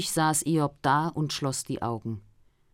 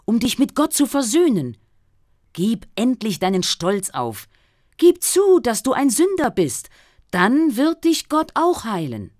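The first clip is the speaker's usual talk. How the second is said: raised, louder voice of an indignant friend; reproachful friend; 4 semitones higher, 6 dB louder than usual. Acted. Friend berating the suffering Job, indignant and loud. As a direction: scold Job as a pious friend who can't understand him.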